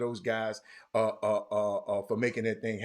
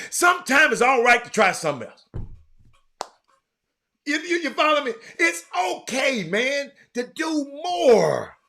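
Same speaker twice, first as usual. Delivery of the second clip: louder and higher, stretched out at the end; preachy shout; 12 semitones higher, 7 dB louder than usual. Real, not acted. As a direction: preach it to the crowd, drawing out the last words. Urging viewers to try new things.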